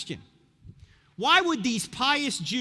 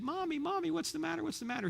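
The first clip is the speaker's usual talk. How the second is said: put-on small child asking; 9 semitones higher, 7 dB quieter than usual. Toddler asking his weeping mother.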